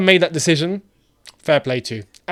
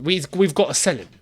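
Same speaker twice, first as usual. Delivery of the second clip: usual speaking voice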